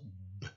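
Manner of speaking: B as a lone "b" burst; bare letter sound. Shown as a demonstration. Teacher on hunting words with the sound, B as bare "b". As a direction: sound out B without saying "bé". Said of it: "des mots avec le son".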